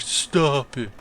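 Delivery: soft, weary plea; tired "stop it". Retold or imitated. Anno quietly pleading with Ron, weary. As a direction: let it sag out quietly. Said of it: "Anno was like".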